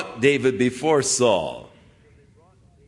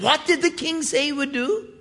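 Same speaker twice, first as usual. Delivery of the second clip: much higher, bright boyish put-on voice; eager young kid. Young David asking the soldiers about the reward, high and eager. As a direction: ask it like an excited kid. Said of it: "and he said".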